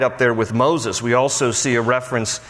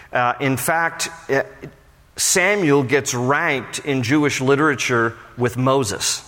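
usual speaking voice

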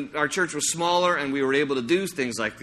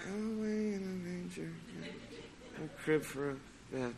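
much softer, limp half-hearted singing; bored teen mumble; 2 semitones higher, 16 dB quieter than usual. Retold or imitated. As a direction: mumble it like a self-conscious teenager. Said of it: "now it's gonna be like".

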